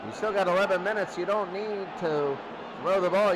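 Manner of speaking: usual speaking voice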